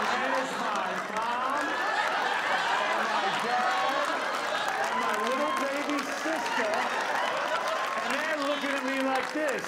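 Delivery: loud, drawn-out stunned reveal; shocked disbelief. Retold or imitated. Stunned son spotting mom, dad and sister in the crowd. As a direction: stretch it out, stunned to see them there.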